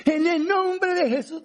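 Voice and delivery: high, slow, ringing shout of the holy name; mock pentecostal shout. Pentecostal preacher shouting at a demon, slow high mock yell. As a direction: bellow it like a preacher casting out demons.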